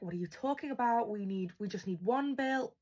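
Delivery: ordinary delivery, nothing marked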